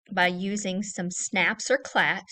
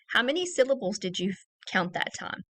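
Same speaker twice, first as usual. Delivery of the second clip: ordinary talk, nothing marked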